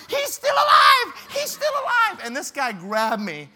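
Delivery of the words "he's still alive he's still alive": high, loud, slow panicked yell; hammed-up alarm; panicked shout. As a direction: shout in terror at the snake. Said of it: "I'm like".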